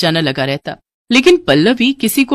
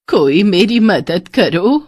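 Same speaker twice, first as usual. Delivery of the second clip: slow, drawn-out plea for help; suffering old woman; slower than usual. Acted. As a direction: beg like an ailing old beggar. Sick old woman begs for help. (लाचार बुढ़िया की पुकार)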